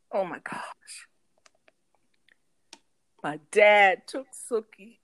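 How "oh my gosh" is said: shocked whisper. Retold or imitated. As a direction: breathe it out in shock. Mom's shocked reaction.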